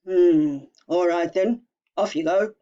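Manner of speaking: loud, gruff troll giving in; grudging troll. Acted. Troll letting the little goat pass, grudging and loud. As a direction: let the goat pass, grumbling.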